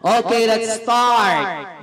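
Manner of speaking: slow host call, stretching "start"; drawn-out game call. Real, not acted. Party host launching the children's greeting game, drawn out. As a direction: call the start with long, held words.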